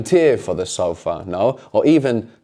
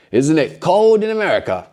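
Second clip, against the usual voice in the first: emphatic, insisting tone; emphatic question; 6 semitones higher than usual. Shown as a demonstration. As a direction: lean on "cold" to insist. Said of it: "We might just be being emphatic".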